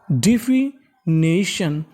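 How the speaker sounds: Definition stretched slowly, part by part; teacher models definition slowly; slow syllable by syllable. Shown as a demonstration. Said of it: "meaning मिलाते हैं". (धीरे-धीरे शब्द तोड़कर)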